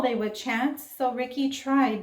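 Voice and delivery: usual speaking voice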